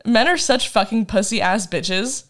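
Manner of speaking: normal talking voice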